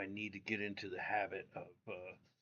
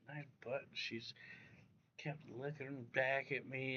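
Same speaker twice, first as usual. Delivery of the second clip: ordinary talk, nothing marked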